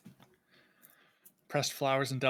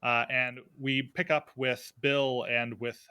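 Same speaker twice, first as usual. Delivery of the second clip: usual speaking voice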